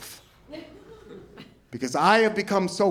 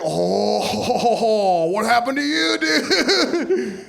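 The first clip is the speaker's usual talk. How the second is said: curious ghost; 4 semitones higher, much slower than usual. Pretend. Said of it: "They're gonna be like".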